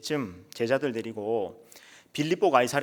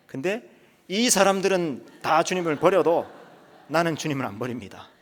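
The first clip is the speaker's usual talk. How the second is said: boastful comparison; 5 semitones higher, 5 dB louder than usual. Acted. Peter setting himself above the other disciples, boastful. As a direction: look down on the others while vowing. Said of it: "그렇게 말합니다".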